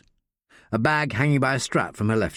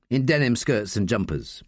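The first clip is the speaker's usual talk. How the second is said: ordinary delivery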